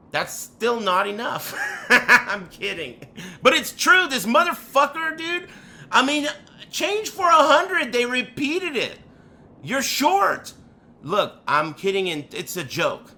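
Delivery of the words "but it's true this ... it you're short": higher, louder playful indignation; higher, louder mock complaint; mock outrage. Real, not acted. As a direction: rant in jest about the short change. Mock outrage over the change.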